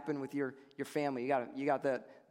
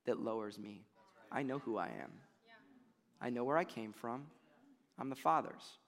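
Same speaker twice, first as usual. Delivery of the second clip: calm Jesus; 3 semitones lower, 7 dB quieter than usual. Retold or imitated. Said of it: "And he's like".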